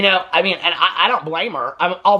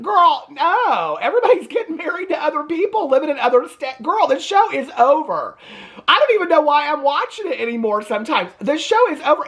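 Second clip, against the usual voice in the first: exasperated sass; 10 semitones higher than usual. Real, not acted. Recapper exasperated at the family, fed-up and sassy. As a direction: throw your hands up at the show.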